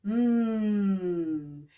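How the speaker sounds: sustained hum with a gentle pitch glide; long steady hum. Shown as a demonstration. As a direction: hum out on one breath. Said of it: "take it into a hum".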